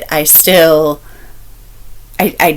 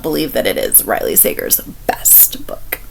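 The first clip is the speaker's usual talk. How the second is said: a confiding whisper; whispered aside; 7 semitones lower, 8 dB quieter than usual. Real, not acted. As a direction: lean in and whisper it like a secret. Confiding verdict on author.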